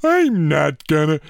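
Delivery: slow, mangled mock American accent; bad American accent. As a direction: botch an American accent like a confused Homer Simpson. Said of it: "like Homer Simpson, who's had a stroke".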